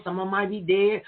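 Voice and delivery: ordinary talk, nothing marked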